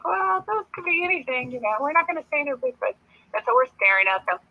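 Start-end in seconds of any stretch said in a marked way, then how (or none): 0.0-2.9 high, airy put-on voice for a doubtful group aside; much higher, mock-innocent "could be anything"; mock-cautious quote. Joking with her group about eye shine, high mock voice. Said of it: "We're like".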